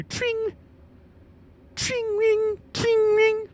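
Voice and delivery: put-on very high, ringing old-phone trill; piercing mock phone bell, repeated; telephone ring imitation. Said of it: "named after the sound that an old tele-, old rotary telephone makes".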